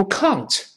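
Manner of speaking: slow mistaken "can't" with "ng"; wrong British can't. Shown as a demonstration. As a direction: show the bad "can't" nobody understands. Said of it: "你要读can't".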